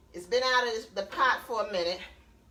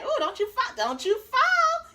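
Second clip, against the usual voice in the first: high playful coaxing; 13 semitones higher, 4 dB louder than usual. Real, not acted. Talking to chicken piece.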